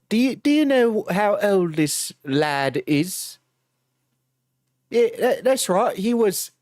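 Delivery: a British bobby scolding in cockney British; mock cockney British copper; British accent. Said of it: "Can you do it in a British accent now?".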